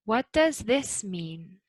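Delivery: slow, does lifted, then falling to mean; rise then fall. Shown as a demonstration. Teacher repeating the WH question, rise then fall. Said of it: "Notice how we go up and then down".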